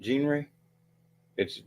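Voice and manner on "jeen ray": "Genre" as a higher, questioning JEEN-ray; imitated mispronunciation. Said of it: "the way she said it".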